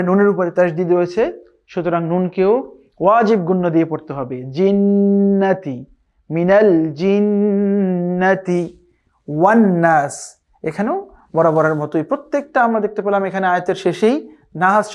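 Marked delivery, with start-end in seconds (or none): 4.4-10.4 drawn-out melodic tajweed chant; slow Quran recitation. Shown as a demonstration. Teacher recites slowly for students to copy. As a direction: recite each syllable long and measured.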